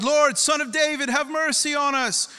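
high, loud cry for mercy; shouted plea. Acted. Blind men crying out to Jesus, high loud plea. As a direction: call out desperately from the roadside. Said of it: "they shouted".

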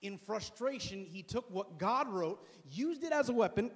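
ordinary talk

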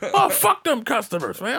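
high, laughing imitation; comic high-pitched scorn; dismissive old driver. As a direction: wave it off as a cranky old man. Old driver dismissing customers, retold.

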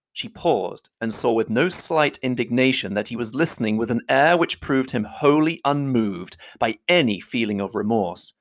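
plain reading voice

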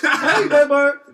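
high, loud cheery "Hey, neighbor!" through laughter; laughing greeting. Retold or imitated. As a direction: shout the greeting while cracking up.

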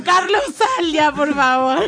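high, drawn-out calling; mock urgent plea. Host retells begging her co-host to come out, mock urgent call. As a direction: call someone out, playing up the urgency.

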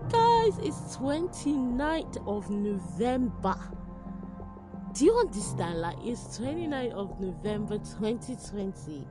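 emphatic. Real, not acted. Stressing the date.

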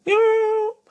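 shrill high meow; cat meow. Acted. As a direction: squeal like a scared kitten. Kitten chased up tree. (schrilles Miauen)

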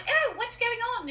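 put-on high-pitched little girl's British accent; English dialect. Mother imitating her daughter's scene, high English dialect. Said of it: "she talks like this".

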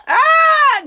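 sudden high yelp of disbelief; shocked cry. Real, not acted. Reacting to her friend's club story, a high shocked cry. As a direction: blurt it out, stunned.

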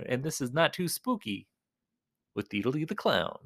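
ordinary delivery

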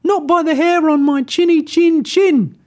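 high, loud defiant pig shout. Acted. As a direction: yell it back through the door. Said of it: "shouted the pig".

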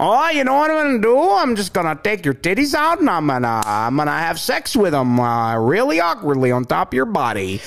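hammed-up Canadian dirty talk, starting very high; awkward mock seduction with Canadian vowels; broad Canadian caricature, sliding down in pitch; northern Canadian accent. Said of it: "So many Canadians are gonna get mad".

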